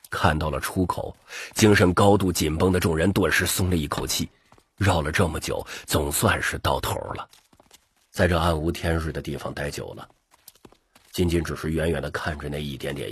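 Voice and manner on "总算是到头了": usual speaking voice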